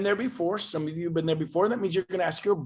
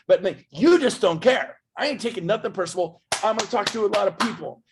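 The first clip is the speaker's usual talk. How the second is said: ordinary talk, nothing marked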